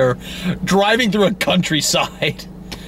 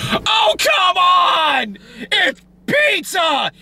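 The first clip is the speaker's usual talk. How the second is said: high, slow, exasperated mock yell; exasperated shout; much slower than usual. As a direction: shout it like the pizza fight is absurd. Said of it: "I'm like".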